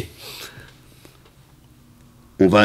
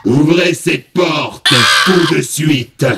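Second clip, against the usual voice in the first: terrifying demon voice; 7 semitones higher, much slower than usual. Acted. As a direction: bark it out like a demon lord. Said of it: "la voix terrifiante de Bouchache". (voix démoniaque terrifiante)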